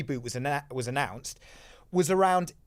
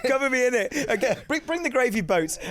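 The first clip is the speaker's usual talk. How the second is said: high, laughing mock gush; high giggly voice; 9 semitones higher than usual. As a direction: squeal it out through giggles.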